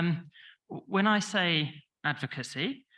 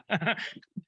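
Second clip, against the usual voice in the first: short laugh; 5 semitones higher than usual. Real, not acted. Laughs at own joke.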